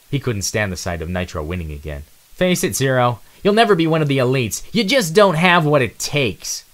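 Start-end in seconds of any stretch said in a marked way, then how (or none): 2.3-6.6 higher, louder, slowing smug jeer; smug taunt. Acted. Nitro taunts Zero about never being elite, smug. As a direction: rub his nose in it. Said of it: "Nitro said smugly".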